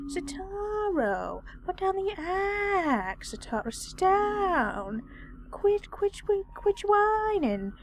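high, swooping whiny plea; whining. Real, not acted. Player begs Sitaro to put down the axe, whining. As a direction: whine and drag out the vowels. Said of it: "I'm the one whining".

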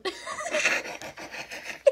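high airy giggle of disbelief; a soft stunned laugh; breathless laugh. Real, not acted. Kid laughing at his pull to dad.

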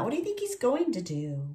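ordinary delivery, nothing marked

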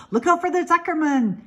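high gander yell; gander yelling warning. A narrator's reading. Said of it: "yelled the gander".

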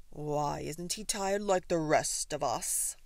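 loud bear's grumble; growling bear. A narrator's reading. Bear complaining about the wide-awake bird, growling. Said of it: "growled the Bear".

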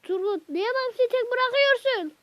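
high, loud telling-off of a teammate; angry scolding. Real, not acted. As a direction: scold Turgut loudly for leaving Bamsı alone. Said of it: "Çok, çok sinirlendim ben bu Turgut'a".

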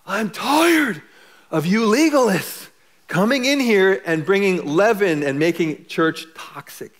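high, loud exasperated cry; fed-up outburst. Acted. Preacher voicing the apostle fed up with legalists, exasperated shout.

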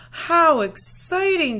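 slow, high and loud, drawn-out delight; excited grandma. Acted. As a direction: gush over the shoes like a thrilled grandma. Grandma reacts to the shoes.

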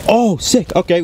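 high, happy burst; thrilled at the catch; excited. Real, not acted. Catching a tadpole.

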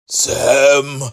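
usual speaking voice

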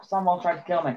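ordinary talk, nothing marked

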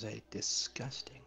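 slow disgusted whisper. Pretend. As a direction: hiss it in mock disgust. Mock disgust aside.

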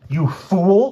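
high, loud outraged cry. A narrator's reading. Francine cries out at the careless druggist, outraged. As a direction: hurl it out in horror. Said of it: "cried Francine".

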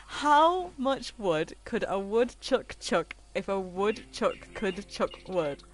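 slow, deliberate tongue twister; careful tongue twister. Shown as a demonstration. Streamer trying a viewer's tongue twister, slow and careful. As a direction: pick through each word unhurriedly. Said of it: "I'm not gonna say that fast".

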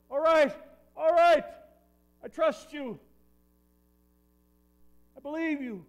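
high, loud, panicky yes-yes; a desperate man agreeing to anything; frantic agreement. Acted. Frightened climber answering the voice.

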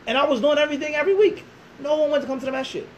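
worked-up, high-pitched self-defence; indignant protest. Retold or imitated. Young imam defending himself to his critics, indignant protest. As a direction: plead your case, pitched high and pushed.

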